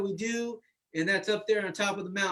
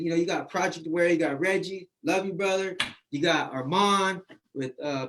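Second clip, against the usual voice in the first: ordinary delivery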